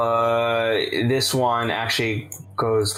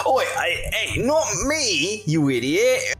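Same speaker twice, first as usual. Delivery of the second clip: angry thug; 12 semitones higher, slower than usual. Acted. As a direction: snap at the clumsy elf, slowly. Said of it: "this man turns back around at you and it says".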